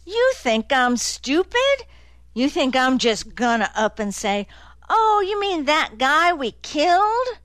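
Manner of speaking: rising indignation, mocking the idea; agitated, sarcastic retort, pitched high; wound up. A narrator's reading. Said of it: "getting wound up".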